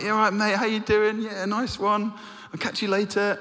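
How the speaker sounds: high, breezy mateyness, imitating friends; cheery blokes. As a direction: play the lads all chummy and casual. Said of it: "and they're like".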